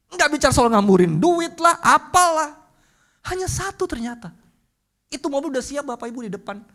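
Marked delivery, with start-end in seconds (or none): 0.1-4.3 loud preaching. Acted. Preaching at full force.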